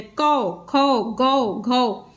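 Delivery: each syllable with au drawn out slowly, one by one; patient teacher reading the chart slowly; slow syllable drill. Shown as a demonstration. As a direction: chant the au sound on every consonant, slowly. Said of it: "एक बार देखिए आप कैसे पढ़ा जाएगा".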